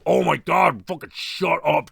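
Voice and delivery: ordinary talk, nothing marked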